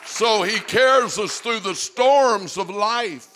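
slow, loud, high preacher's cry; preached shout. Acted. Pastor assuring the church, slow preached shout. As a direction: stretch it out and shout it.

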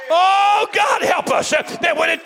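full-force preacher; fiery preaching. Acted. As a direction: drive it home to the congregation.

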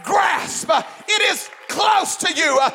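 loud, high, shouting preacher; fiery preaching. Acted. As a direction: preach it full force, building. Preaching at full force.

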